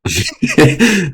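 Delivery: high stifled giggle; held-back laugh. Real, not acted. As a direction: laugh you try to swallow. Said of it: "I'm laughing because I'm holding back".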